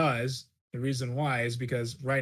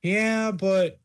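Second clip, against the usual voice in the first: playful excited; 6 semitones higher, slower than usual. Real, not acted. Playful retort to happy wife.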